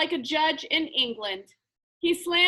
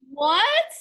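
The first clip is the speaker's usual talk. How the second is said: higher, louder whine; a high, louder whiny "What?"; whining; 7 semitones higher, 5 dB louder than usual. A narrator's reading. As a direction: whine it in protest. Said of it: "whined Peckelman".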